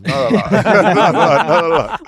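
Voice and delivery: laughing, loud and high. Real, not acted. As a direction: laugh through the words. Laughing, teasing the goalkeeper.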